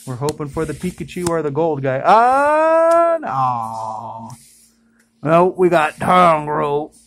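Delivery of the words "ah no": soar with hope, then drop into disappointment; big gasp of hope deflating; hopes dashed. Real, not acted. Hoped-for card turns out wrong.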